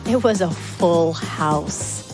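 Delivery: plain reading voice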